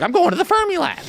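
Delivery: high, eager know-it-all voice; mock Michael voice. Telling a friend how the plane seatmate talked, high and eager. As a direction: pitch it way up like an excited nerd. Imitating Smart Michael's excited voice.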